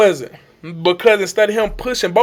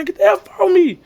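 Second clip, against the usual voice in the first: high re-enacted protest; distressed refusal; 12 semitones higher than usual. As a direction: fend off help in a high voice. Re-enacts pushing helper away.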